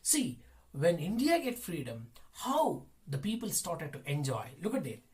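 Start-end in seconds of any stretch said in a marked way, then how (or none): none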